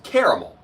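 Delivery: "caramel" as KAIR-uh-mul; Google's pronunciation. Retold or imitated. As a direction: quote "caramel" in three syllables, KAIR-uh-mul. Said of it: "Google's trying to tell me that caramel is pronounced".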